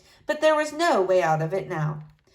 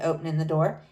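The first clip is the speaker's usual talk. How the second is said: usual speaking voice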